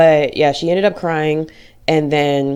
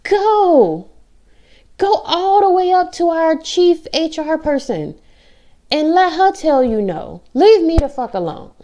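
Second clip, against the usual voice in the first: defiant; 10 semitones higher, slower than usual. Real, not acted. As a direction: dare them, fed up and furious. Defiant rant at pushy colleagues.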